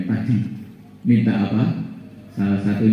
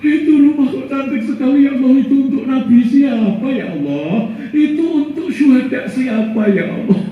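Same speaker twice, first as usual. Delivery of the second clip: higher, marveling voice of the man; awed questioner; 13 semitones higher than usual. Acted. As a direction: ask God in wonder. Man asks God in wonder. (suara takjub bertanya kepada Allah)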